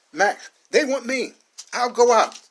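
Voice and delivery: high, slow, loud changed voice; a young man giving himself up; resolved offer. Acted. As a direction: say it with grim resolve. Max offers to surrender.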